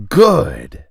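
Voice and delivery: usual speaking voice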